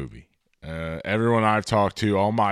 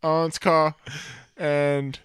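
high soft Kiwi Korg voice; New Zealand accent; 7 semitones higher than usual. Retold or imitated. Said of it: "South New Zealand accent, just this soft tone".

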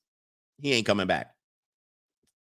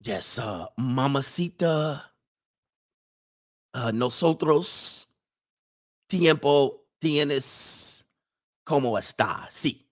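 slow, hesitant, fumbling Spanish; halting beginner Spanish; 2 semitones lower, much slower than usual. Retold or imitated. Brothers trying Spanish on women, slow fumbling beginner Spanish. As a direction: stumble through Spanish words like a beginner. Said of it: "They in here like".

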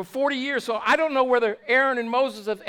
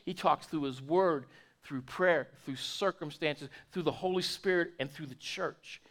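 quiet; 7 semitones lower, 7 dB quieter than usual. Real, not acted. Repeated list, dropped low.

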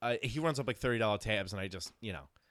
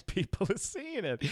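laughing through the words, slower; 8 semitones higher, 3 dB quieter, slower than usual. Real, not acted. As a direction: repeat it while cracking up. Laughing repeat of co-host's line.

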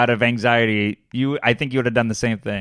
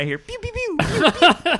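high playful pew pew game sounds, much higher pitch; mock laser zaps. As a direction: zap like an arcade game, laughing through it. Imitating a video game zapping.

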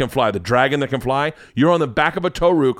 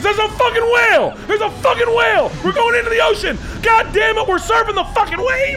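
very high mock-terrified shrieks; hammed-up alarm at full pitch; dad screaming in panic; 3 dB louder than usual. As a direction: imitate a dad yelling curses at the ride. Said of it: "That's my dad the whole fucking ride".

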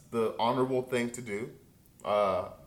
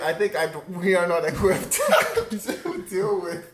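laughing through words; 6 semitones higher, 5 dB louder than usual. Real, not acted. Host laughing at caller.

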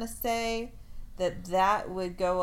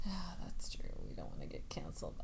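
whisper; 10 dB quieter, faster than usual. Pretend. Mock-chastened reply to cancel warning.

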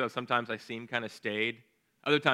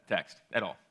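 ordinary talk, nothing marked

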